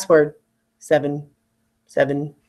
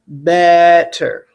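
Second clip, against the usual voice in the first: Better, louder on BET with a falling end; stressed first syllable; 6 dB louder than usual. Shown as a demonstration. Teacher showing where the stress falls. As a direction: hit BET hard in Better. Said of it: "It's in the stressed part".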